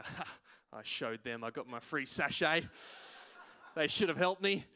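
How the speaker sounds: low, self-satisfied mock gloating; smug attitude. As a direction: gloat over a petty victory. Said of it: "have that kind of attitude".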